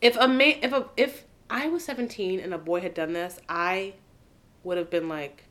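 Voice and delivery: ordinary delivery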